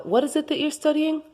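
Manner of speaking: usual speaking voice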